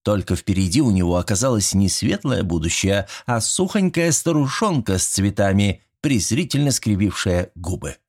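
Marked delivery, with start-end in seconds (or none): none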